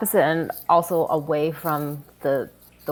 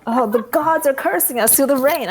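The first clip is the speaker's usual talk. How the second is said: loud, high cry of alarm; theatrical doomsayer cry; 9 semitones higher, 6 dB louder than usual. Acted. Acting out the distraction line.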